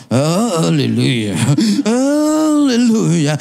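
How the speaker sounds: very slow, drawn-out haleluya, rising at the end; mock ecstatic prayer. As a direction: chant it like a charismatic prayer leader. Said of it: "Saya ngomong". (haleluya dipanjangkan seperti doa penuh Roh)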